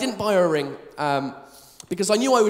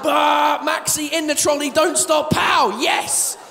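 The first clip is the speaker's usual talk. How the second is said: triumphant shout; 3 semitones higher, slower than usual. Retold or imitated.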